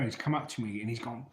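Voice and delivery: normal talking voice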